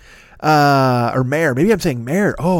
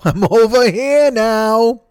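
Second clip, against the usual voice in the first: higher, very slow, through a laugh; slow laughing repeat; 7 semitones higher, 5 dB louder, much slower than usual. Acted. Host teasing listeners, slow and higher with a laugh. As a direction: stretch every word out, giggling. Repeats line, second time drawn out.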